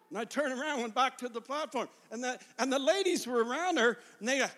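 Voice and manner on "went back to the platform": ordinary talk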